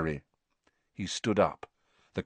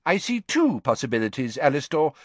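higher, blustering official tone; a fussy minister dismissing concerns; pompous minister; 6 semitones higher than usual. A narrator's reading. Fudge's voice.

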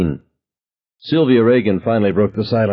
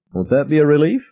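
said smiling; 9 semitones higher than usual. A narrator's reading. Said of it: "I said, smiling".

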